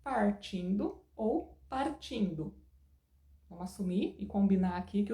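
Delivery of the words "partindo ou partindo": "partindo ou partindo" slowed right down, a different R on each; regional R variants. Shown as a demonstration. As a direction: spell out two other regional Rs in "partindo", slowly. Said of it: "eu posso falar partindo".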